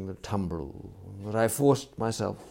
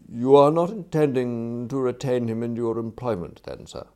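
smooth, louder formal inquiry falling away at the end; deferential valet; 3 semitones higher, 8 dB louder than usual. Acted. Valet asks about retaining a servant, deferential and measured. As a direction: probe politely, as a discreet butler. Jeeves's question, echoed by Wooster.

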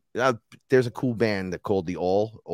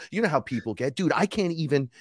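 ordinary delivery

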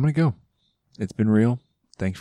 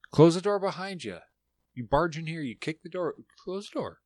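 high-pitched real scolding; sharply higher, exasperated command to pets; scolding the dogs; 10 semitones higher than usual. Real, not acted. Host scolding dogs who barged in, high-pitched and exasperated.

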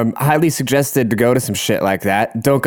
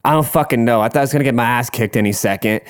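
ordinary delivery

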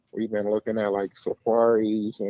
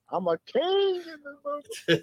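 high mock royal voice; 4 dB quieter than usual. Boasting in a high put-on voice.

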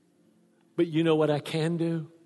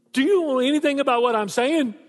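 son's disbelief; 10 semitones higher, 7 dB louder than usual. Retold or imitated. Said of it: "he goes".

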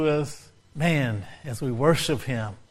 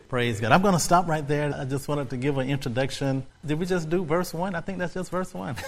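usual speaking voice

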